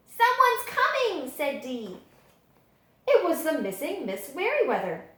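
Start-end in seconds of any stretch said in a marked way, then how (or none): 0.1-1.3 high, slow warning; high child's voice, alert; excited warning. Acted. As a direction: warn the others that someone's coming. Child warns others.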